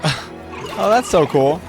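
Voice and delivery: normal talking voice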